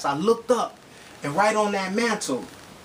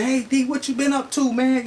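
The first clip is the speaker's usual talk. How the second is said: friendly retold greeting; 7 semitones higher than usual. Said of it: "stop and say".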